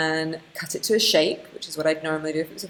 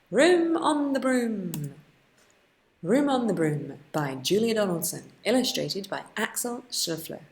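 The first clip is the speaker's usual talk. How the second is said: put-on reading to children; 4 semitones higher than usual. Teacher opening a story for infant students, storybook read. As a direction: announce the book title like story time begins. Reads book title to children.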